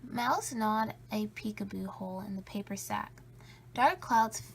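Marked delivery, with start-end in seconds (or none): none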